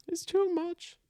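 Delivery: high-pitched playful echo; high mock echo. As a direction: echo it back in a silly high voice. Repeating partner's words mockingly.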